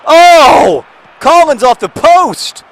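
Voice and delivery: high, very loud shout; loud, drawn-out match call; excited outburst. Real, not acted. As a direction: cry out at the near miss, stretching the words. Reacts to shot hitting post.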